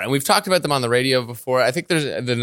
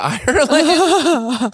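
laughing; 12 semitones higher, 4 dB louder, much slower than usual. Real, not acted. Laughing while reporting odd story.

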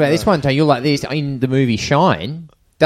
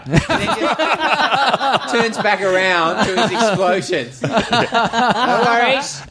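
laughing; 9 semitones higher, much slower than usual. Real, not acted. Laughing at own story.

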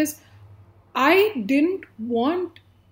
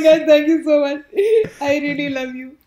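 mock tearful thanks; 2 semitones higher, 5 dB louder than usual. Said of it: "You're like".

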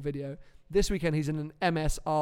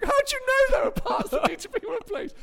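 high incredulous question; 3 dB louder than usual. Retold or imitated. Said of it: "I was like".

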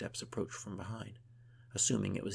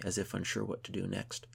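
ordinary delivery

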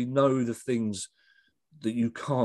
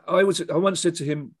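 ordinary talk

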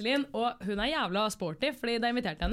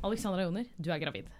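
hushed, much softer and lower "Alexandra Joner, du er gravid"; mock solemn announcement; 5 semitones lower, 6 dB quieter than usual. Host mimicking a dreaded pregnancy reveal, hushed and grave. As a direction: drop to a grave hush for the big reveal. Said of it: "Vet du hva jeg trodde du skulle si".

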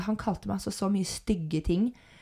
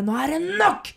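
raised, higher voice, laying down the law; acted angry outburst; 8 semitones higher, 4 dB louder than usual. Imitating a man who slams the table, angry outburst. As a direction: slam it down like a fist on the table. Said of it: "slår hånda ned i bordet og bare".

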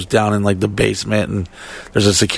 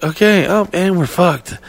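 high-pitched retold dismay; high, helpless little voice; mock resignation; 8 semitones higher than usual. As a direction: go up high as the bad news sinks in. Retells his dawning panic.

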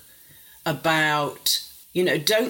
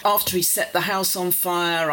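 normal talking voice

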